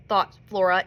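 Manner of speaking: ordinary delivery, nothing marked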